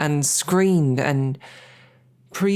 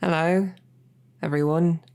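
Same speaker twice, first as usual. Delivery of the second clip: raised, higher voice to get the hall's attention; calling the room; 4 semitones higher than usual. Acted. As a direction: speak up over the banquet guests, higher. Addressing the banquet crowd.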